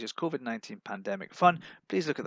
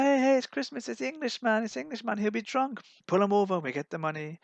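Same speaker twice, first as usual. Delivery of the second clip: mocking police voice; 10 semitones higher than usual. Police spotting the Englishman, high jeering voice. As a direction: jeer at him like a greedy policeman. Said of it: "he said".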